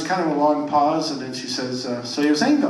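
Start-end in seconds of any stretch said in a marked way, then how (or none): 2.0-2.7 eager hopeful question. Acted. Punchline, eager old lady.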